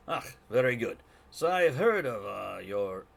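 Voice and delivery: loud, lilting attempt at Scottish; wandering Scottish accent. [name] addressing the newcomers, in a wandering Scottish accent. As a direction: let the Scottish accent drift off course. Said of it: "now he's wandered to a different country".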